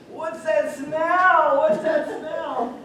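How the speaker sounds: high, very loud, drawn-out scream; hammed-up screech at a stink, stretching each word. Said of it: "all her screaming and louding".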